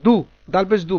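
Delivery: দাল পেশ দু, the দু like Bangla দ; correcting to du. Shown as a demonstration. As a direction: fix জু to দু, clearly. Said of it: "Bangla দয়ের মতো".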